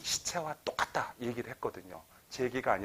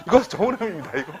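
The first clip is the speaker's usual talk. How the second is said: 이거 좋은 의미입니다 said laughing, higher and louder; 5 semitones higher, 7 dB louder, faster than usual. Real, not acted. Lecturer repeats to the audience that it is meant kindly, laughing. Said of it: "일부러 써는 거예요".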